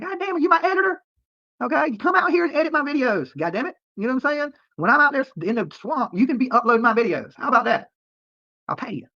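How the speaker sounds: high-pitched exasperated rant; indignant comeback. Real, not acted. Streamer to viewer demanding search videos, indignant comeback. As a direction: throw the demand back, pitch way up.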